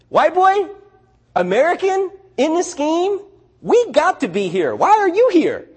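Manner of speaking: loud incredulity. Retold or imitated. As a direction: imitate a loud, high, incredulous friend. Retold incredulous reply from Mez.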